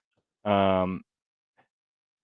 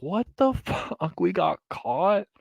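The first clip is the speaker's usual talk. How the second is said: mock disbelief; 7 semitones higher than usual. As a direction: play the stunned kids squeaking in disbelief. Mocking caught kids' disbelief.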